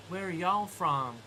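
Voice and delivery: slow mock Southern US drawl; Southern American accent. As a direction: imitate a Tennessee local asking. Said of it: "We got a lot of that in the States".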